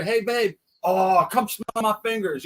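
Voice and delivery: high-pitched mock call, not yelled; husband calling wife. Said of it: "if your neighbor's here".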